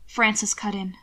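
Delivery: plain reading voice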